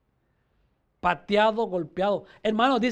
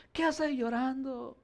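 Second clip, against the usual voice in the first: much quieter, timid version; soft, meek question; 3 semitones higher, 9 dB quieter than usual. Shown as a demonstration. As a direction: say it gently, barely voiced. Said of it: "No le dijo".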